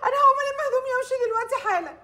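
tearful threat. Acted. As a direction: sob out that she is leaving now. Crying bride threatens to leave. (تهديد وهي بتعيط)

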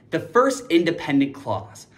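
normal talking voice

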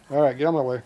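loud get-out-of-my-way at the dog; telling the dog to move. Real, not acted. Dog blocking the work.

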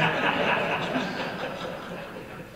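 short breathy laugh at his own joke; real laugh. Real, not acted. Preacher laughing at his Marines-and-Army joke, real laugh. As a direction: laugh at the joke you just made.

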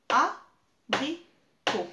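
abricot slow and loud, a-bri-cot, one beat each; syllable by syllable. Shown as a demonstration. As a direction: clap out abricot in three slow beats. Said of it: "trois syllabes". (abricot scandé lentement)

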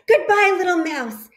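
high, hurried fearful farewell; nervous goodbye. Acted. Snake flees the Gruffalo with a high nervous goodbye. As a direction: back away in a hurry.

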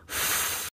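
फ as a voiceless rush of air, no vowel; air-only f. Shown as a demonstration. Teacher comparing फ to a balloon letting out air. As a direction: let air escape like a balloon on फ. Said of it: "जैसे कि balloon में से हवा निकल रही हो".

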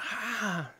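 high, softened imitation scream; mock scream. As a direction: mimic a scream without full volume. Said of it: "She's screaming".

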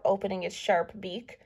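plain reading voice

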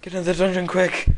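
loud, urgent order; urgent shout. Real, not acted. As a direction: yell to hurry everyone along. Urgent order while fleeing.

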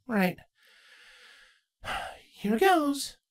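put-on young mare lifted high and light; Twilight's voice. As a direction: voice Twilight, gently doubtful.

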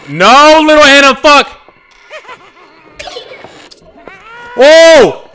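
high, loud shocked yelling; startled screams. Real, not acted. Player ambushed by the girl, shouting in shock.

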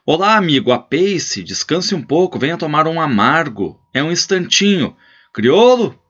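put-on old rancher urging a passing rider to stop; hospitable old gaucho host. Host welcoming a traveller.

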